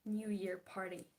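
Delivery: ordinary talk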